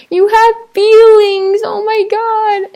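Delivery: high, drawn-out melting gush. Acted. Vlogger moved by guys crying, high drawn-out gushing.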